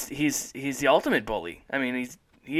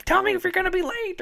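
put-on high, far old lady holler; grandma yelling. Grandma calling her grandson back home. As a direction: call after him like a cranky grandma. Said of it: "or if she yells".

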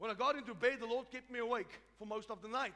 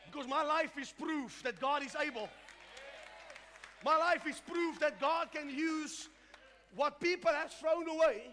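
rally the congregation with raised voice; full-force preaching; 5 semitones higher, 6 dB louder than usual. Real, not acted. Pastor calls the church to shout praise, fervent preaching.

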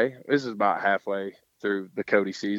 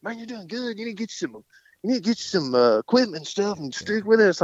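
imitated pep talk, pitched way up; coaxing colleague; 11 semitones higher than usual. Bullfighter urging a beginner to stay, coaxing. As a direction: talk him into staying, in a high put-on voice.

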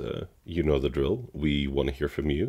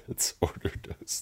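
whisper; 5 dB quieter than usual. Acted. Whispered echo.

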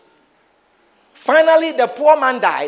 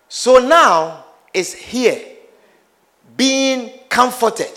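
slow deliberate; much slower than usual. Acted. Abraham's reply, drawn out.

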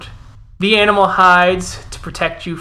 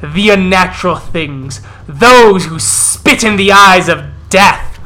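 growling wolf elder; slower than usual. Acted. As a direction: snarl it out like a wolf chief rousing his pack. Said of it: "As he growls and snaps".

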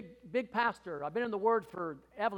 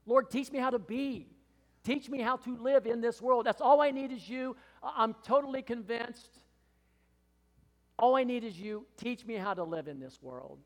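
raised, pleading prayer to the Lord; fervent prayer; 6 semitones higher, 6 dB louder than usual. Retold or imitated. Pastor retelling his tearful prayer, raised and pleading. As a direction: cry out to God from the heart. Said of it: "isn't that just so basic".

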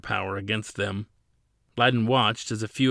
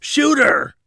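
very high, very loud panicked yell; 11 dB louder than usual. A narrator's reading. Officer ordering his men to fire, panicked loud yell. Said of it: "he yelled".

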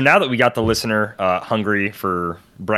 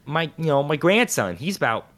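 wife's voice; 8 semitones higher than usual. Retold or imitated. Wife's answer voiced in story.

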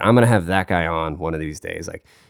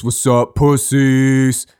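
brash small guy trash talk, higher and louder; mock tough greeting, pitched up; friend Bryce impression; 6 semitones higher, 6 dB louder, much slower than usual. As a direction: imitate a wimpy guy talking big. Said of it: "He talks like this".

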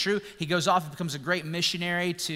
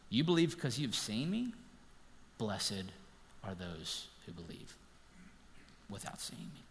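soft, lower, fading to a hush; tender Jesus; 7 semitones lower, 13 dB quieter than usual. Retold or imitated. Jesus tells Thomas softly, almost whispered. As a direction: let the blessing fall quietly. Jesus blessing future believers.

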